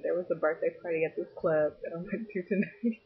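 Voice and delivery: giggling through the words; can't stop laughing; breaking into giggles. Real, not acted. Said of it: "Okay, I'm gonna stop laughing".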